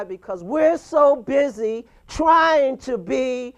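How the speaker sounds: rising into a loud, fired-up sermon; preaching. Acted. Speaker lecturing listeners on pretenders, fired-up preaching. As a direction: hammer the point home like a preacher. Motivational rant on pretenders, contenders.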